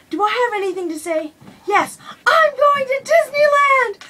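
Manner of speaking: mock Daniel Bryan bursting out with glee; gleeful shout. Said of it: "He's like".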